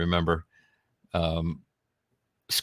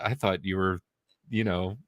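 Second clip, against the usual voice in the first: ordinary talk, nothing marked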